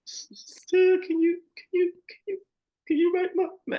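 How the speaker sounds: high, breathy sobbing plea; whispered through tears. Retold or imitated. Asking her friend for help, she cries through a whispered plea. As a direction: whisper it as the tears take over. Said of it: "I couldn't even ask her without crying".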